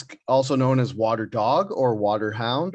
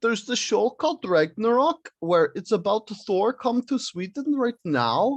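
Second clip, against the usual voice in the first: high-pitched put-on Swedish accent; hammed-up Swedish English; 8 semitones higher than usual. Shown as a demonstration. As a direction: demonstrate a lilting Swedish accent. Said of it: "it kind of sounds like this".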